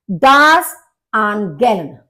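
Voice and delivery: Das Angeln very slowly, in parts; slow split-up word. Shown as a demonstration. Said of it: "अलग-अलग divide करके बोलना चाहिए". (Das Angeln को धीरे-धीरे)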